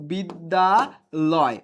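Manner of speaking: বিদ্যালয় stretched very slowly as one word; joined word, drawn out. Shown as a demonstration. Teacher to online class, বিদ্যালয় drawn out very slowly. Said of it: "এটাকে যদি আমি একসাথে করি".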